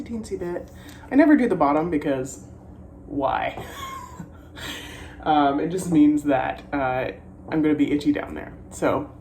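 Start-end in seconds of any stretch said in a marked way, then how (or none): 3.7-5.1 breathy laugh. Real, not acted. Laugh after joking question.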